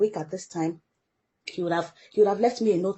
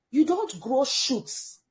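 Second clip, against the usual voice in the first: ordinary talk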